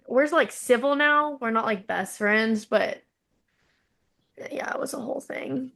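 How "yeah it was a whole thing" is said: whispered, quick and breathless; a quick hushed aside. Real, not acted. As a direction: drop to a whisper.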